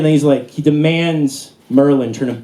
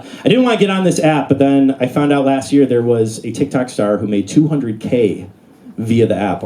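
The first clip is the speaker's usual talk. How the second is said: ordinary talk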